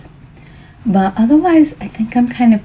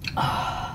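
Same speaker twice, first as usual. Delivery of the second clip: long soft sigh; contented sigh; 10 dB quieter than usual. Real, not acted. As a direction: breathe out slowly, satisfied. Sighs after tasting the tea.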